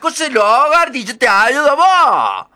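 high, loud character voice; Madre Nieve's voice. Acted. Madre Nieve asks the girl to help with housework, high and loud. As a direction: coax her into helping.

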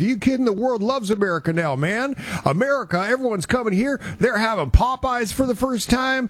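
high-pitched mock voice of an excited buddy; Brandon. Host quoting his buddy in L A, loose high-pitched impression. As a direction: imitate an incredulous friend, pitched well up. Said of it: "Brandon does not sound like that".

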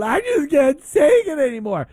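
high, whiny mock cry of a driver who cannot cope; mock sobbing driver. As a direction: ham up a tearful breakdown at the wheel. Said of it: "behind the steering wheel going".

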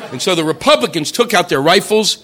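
ordinary talk

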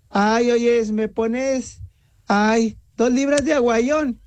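usual speaking voice

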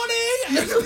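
high-pitched giggle; high laughing squeal. Real, not acted. Host laughing in a high squeal at the mock line.

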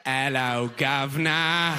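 drawn-out hammed-up Cockney greeting; mock British accent. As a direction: parrot British sounds with silly relish. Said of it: "making sounds you like".

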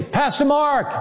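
high call from the balcony; retold yell. Producer calling down to the pastor, mock yell. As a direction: call up the name like across a stadium. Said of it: "and he yelled down at me".

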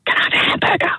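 hushed punchline; whispered punchline. Acted. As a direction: whisper the hamburger request.

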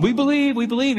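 high, excited crowd chant; eager crowd cheering. Retold or imitated. Crowd at Niagara cheering Blondin on, high and excited. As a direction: cheer it like a thrilled crowd at the falls. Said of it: "He'd work the crowd".

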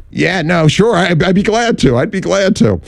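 eager yes. Retold or imitated. Eagerly accepting the job offer.

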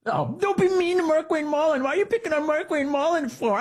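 very high-pitched mocking voice; whiny mock defender. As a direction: plead in a silly high voice, mocking his defenders. Mocks defenders of Mullin, then tells them off.

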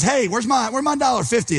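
high, pushy demand for the money; high, hammed-up demand for a dollar fifty; demanding buddy. Forgiven man demands repayment.

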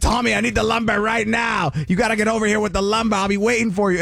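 put-on Boston accent. Voicing caller demanding lumber.

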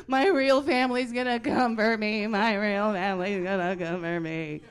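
loud, hammed-up wail; freaking out. Imitating her sister told she's adopted, freaking out. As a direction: imitate a child in a loud meltdown. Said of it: "She started freaking out".

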